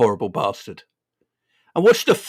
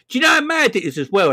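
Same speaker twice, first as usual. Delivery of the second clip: usual speaking voice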